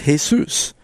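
Jesus said the Spanish way, "hay-SOOS"; Spanish pronunciation. Shown as a demonstration. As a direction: say Jesus as hay-SOOS, stressed on the second syllable. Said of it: "in Spanish".

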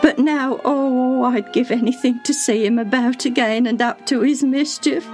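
high, tearful servant voice; long for it with a catch of grief; sobbing. A narrator's reading. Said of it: "she said with another sob".

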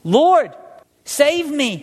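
sinking Peter crying out to the Lord. A narrator's reading. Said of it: "He cried out".

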